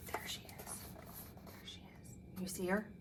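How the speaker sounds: hushed whisper. Acted. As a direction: whisper it like a secret. Reader whispers aside.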